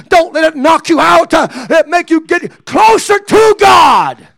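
high, loud shouting exhortation; rally them, slow and loud; shouted preaching. Acted. Preacher urging the church not to quit, shouting high and loud.

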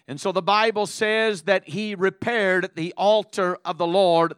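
loud, emphatic sermon delivery; loud pulpit oratory with weighty phrase endings; forceful preaching. Real, not acted. Preaching to congregation at full force.

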